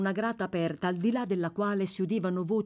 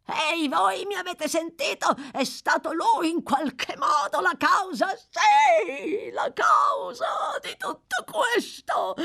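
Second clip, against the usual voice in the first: frenzied high-pitched shouting; wild wailing yell, stretched at the end; 14 semitones higher, 5 dB louder, slower than usual. A narrator's reading. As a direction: rave and accuse at the top of your voice.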